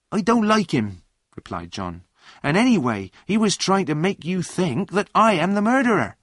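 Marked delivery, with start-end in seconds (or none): 0.1-1.0 high, loud sulky retort; raised-pitch objection; indignant protest. A narrator's reading. John protests when scolded. 2.4-6.2 high, loud protest; aggrieved, emphatic retort; indignant self-defence. A narrator's reading. John defends himself in quarrel.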